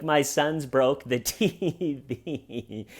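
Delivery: slow, giggling mimic; laughing through words. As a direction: giggle through the line like her. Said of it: "she starts laughing".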